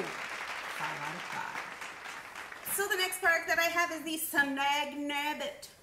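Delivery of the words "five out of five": slow, breath-only whisper; hushed, drawn-out rating; whispered echo. Pretend. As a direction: whisper the rating slowly for effect. Repeats rating after bam.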